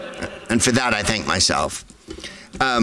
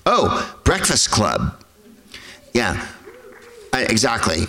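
ordinary talk

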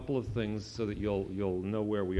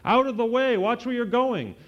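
high, loud warning holler; 14 semitones higher, 8 dB louder than usual. A narrator's reading. Skateboard kid yelling at the pets, loud holler. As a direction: yell at pets blocking the path. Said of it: "I heard someone holler".

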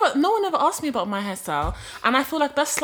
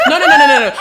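high squealing laughing denial; 12 dB louder, faster than usual. Real, not acted. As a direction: laugh through the nos.

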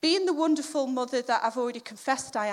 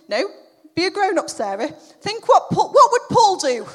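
loud, raised self-scolding; mock self pep talk; 4 semitones higher, 10 dB louder than usual. Mum steadying herself at the dentist, loud mock pep talk. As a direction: give yourself a hammed-up talking-to.

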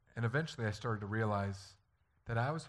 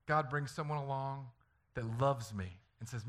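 ordinary talk, nothing marked

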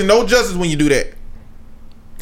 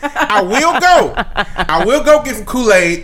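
laughing declaration; slower than usual. Real, not acted.

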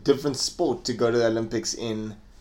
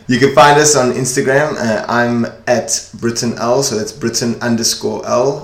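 loud, upbeat show plug; podcast outro read; 6 dB louder than usual. Acted. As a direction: announce it like a radio host.

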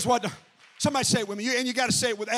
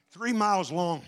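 ordinary talk, nothing marked

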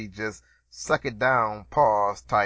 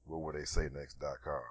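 lower, softer announcer read; deep voiceover; 10 semitones lower, 13 dB quieter than usual. Shown as a demonstration. Host demoing own podcast voiceover, deep and low. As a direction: intone it like a radio promo. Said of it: "I could probably do my own voiceovers".

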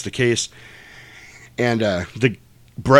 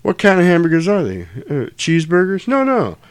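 imitating Jules; 7 semitones higher, 4 dB louder than usual. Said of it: "he goes".

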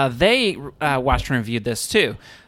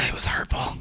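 whispered; 8 dB quieter than usual. Real, not acted. Sighed sad remark after assault.

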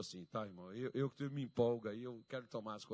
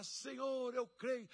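praying believer; 10 semitones higher, much slower than usual. Retold or imitated. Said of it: "Eu falava".

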